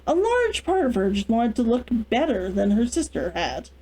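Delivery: usual speaking voice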